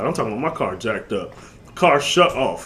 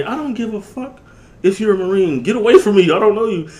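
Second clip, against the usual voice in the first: higher and louder, telling him to back off; angry yell; 9 semitones higher, 5 dB louder than usual. Retold or imitated. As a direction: snap at the stranger to get away. Yelling at the Marine.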